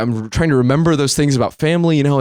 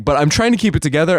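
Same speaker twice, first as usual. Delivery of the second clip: usual speaking voice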